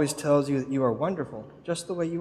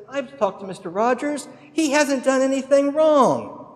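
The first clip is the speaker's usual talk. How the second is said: raised-pitch imitation of an official addressing a crowd; governor's announcement; 9 semitones higher than usual. Governor to the crowd from the courthouse steps, lightly voiced proclamation. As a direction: proclaim it high, stretching the last sentence.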